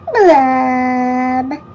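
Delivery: low, drawn-out gloomy blub; sad fish blub. Acted. To the class, a sinking sad blub. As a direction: let the blub sink like a sigh.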